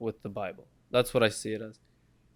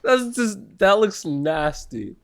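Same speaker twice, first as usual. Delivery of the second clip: high, loud, slow disgust; grossed out; 9 semitones higher, 5 dB louder, slower than usual. Real, not acted. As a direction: drag out the disgust. Mocking friend's pizza.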